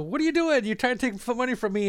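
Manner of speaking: high, indignant mock outburst; agitated vendor. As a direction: imitate the offended vendor, voice jumping up. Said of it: "getting agitated".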